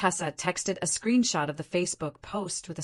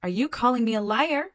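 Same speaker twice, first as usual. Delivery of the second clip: higher, louder, accusing; 6 semitones higher, 4 dB louder, faster than usual. A narrator's reading. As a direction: turn it on him like he's the problem. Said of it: "in a tone like I was the problem".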